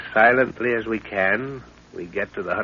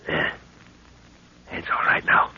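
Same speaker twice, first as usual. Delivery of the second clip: tense whisper; 4 semitones lower than usual. Acted. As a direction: freeze and whisper the warning. Hiding from the guards.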